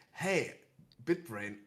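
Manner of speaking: ordinary delivery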